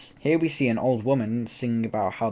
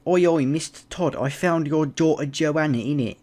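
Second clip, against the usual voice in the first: higher, louder mock Cockney shout; mock Cockney Anthony; 4 semitones higher, 3 dB louder than usual. Anthony bursting in on Mister Todd, mock Cockney call. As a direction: burst in like a cheeky Cockney lad. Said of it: "That's what he sounds like".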